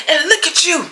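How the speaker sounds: high, mocking man's voice. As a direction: mimic him sneering up high. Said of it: "He was like".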